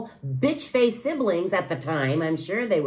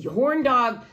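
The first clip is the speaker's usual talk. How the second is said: normal talking voice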